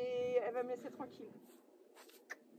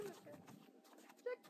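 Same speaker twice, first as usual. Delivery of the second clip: ordinary delivery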